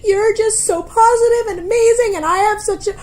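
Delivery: high, loud tearful gushing; exaggerated weepy wail; mock sobbing. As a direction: imitate someone bawling while praising you. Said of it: "sobbing, like, crying her face off".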